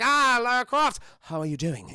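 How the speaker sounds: loud mock British greeting, dropping at the end; British accent. Actor greeting Lara Croft, accent slipping into mock British. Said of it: "slips back into his British accent".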